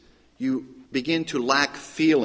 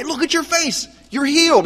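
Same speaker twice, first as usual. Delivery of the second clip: raspy leper voice, amazed; 12 semitones higher than usual. Retold or imitated. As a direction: croak in astonished joy at a friend's healed face. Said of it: "in this kind of raspy voice".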